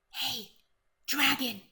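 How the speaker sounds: usual speaking voice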